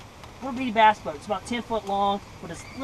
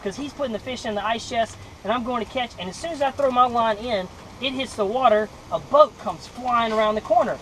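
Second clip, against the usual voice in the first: ordinary talk, nothing marked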